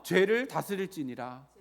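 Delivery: loud, slow model phrase; call-and-repeat reading. Shown as a demonstration. Preacher has congregation repeat the verse, loud measured delivery. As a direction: give the line loudly and deliberately for repeating. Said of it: "다시 한번요".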